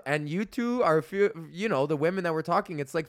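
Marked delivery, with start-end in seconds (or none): none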